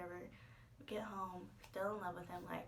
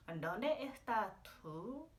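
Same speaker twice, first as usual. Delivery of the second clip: playful Spanish; 2 semitones higher, slower than usual. Retold or imitated. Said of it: "I'm like".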